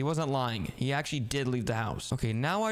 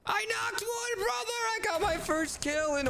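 much higher and slow, then a shout; thrilled shout. Acted. Telling his brother about his first kill, shouting with joy. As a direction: crow over the kill.

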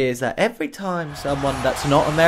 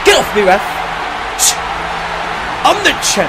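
cocky wrestler; 5 semitones higher, faster than usual. Pretend. Player voices his wrestler to the ref, mock-cocky. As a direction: play the champ shaking off the ref.

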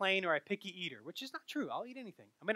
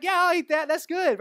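eager; 9 semitones higher, 7 dB louder than usual. Retold or imitated. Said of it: "I'm like".

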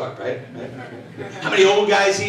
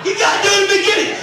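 emphatic; 11 semitones higher than usual. Acted. Mock emphatic advice.

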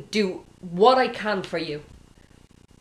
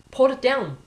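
firm call; 6 semitones higher, 5 dB louder than usual. Retold or imitated. God's firm command, retold.

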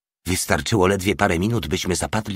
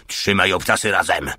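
ordinary delivery

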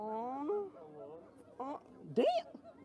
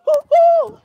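high, loud hooting like an ape; monkey hoot; 13 semitones higher, 13 dB louder than usual. Pretend. Hooting loudly to get the monkey's attention.

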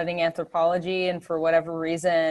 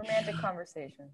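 whispered aside; 3 semitones lower, 11 dB quieter than usual. Real, not acted. Wry aside, unvoiced.

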